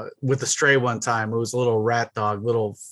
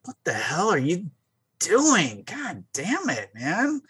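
exasperated; 4 semitones higher, 3 dB quieter, slower than usual. Retold or imitated. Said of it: "you just look at him like".

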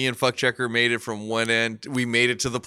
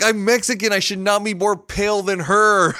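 much higher and louder, daring her; mock defiant shout; 7 semitones higher, 5 dB louder than usual. Retold reply to the doubting waitress, loud and high. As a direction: bark it back at the waitress, hammed-up. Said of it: "I'm like".

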